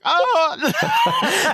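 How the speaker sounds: very high laughing mock scream; mock Grudge scream. Imitating Seth's Grudge-like scream, high and laughing. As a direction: shriek like the Grudge girl, laughing. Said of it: "sounded like the chick from The Grudge".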